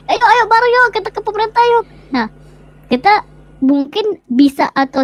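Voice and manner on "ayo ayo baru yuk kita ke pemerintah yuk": high-pitched imitation of eager recruiting; rally everyone along, pitched well up; imitating an enthusiastic crowd-gatherer; mock rallying call.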